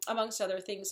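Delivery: normal talking voice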